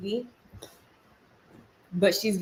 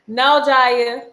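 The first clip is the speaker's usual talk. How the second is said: loud, higher scolding call; firm parental no; 5 semitones higher, 7 dB louder, much slower than usual. Real, not acted. Calls out to her child.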